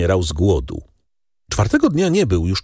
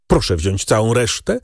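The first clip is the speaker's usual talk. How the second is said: plain reading voice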